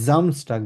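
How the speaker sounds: জামস্টাগ with a buzzing Z at the start. Shown as a demonstration. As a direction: model জামস্টাগ, the S voiced like Z. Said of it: "এস কে আমরা জেড এর মতো উচ্চারণ করলে". (জামস্টাগ, S কে Z এর মতো)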